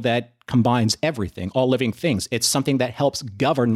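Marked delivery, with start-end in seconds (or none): none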